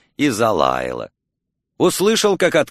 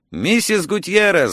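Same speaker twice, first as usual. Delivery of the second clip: raised, higher-pitched narrated yell; 7 semitones higher than usual. A narrator's reading. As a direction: call out the name, pitched up. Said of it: "заорал я".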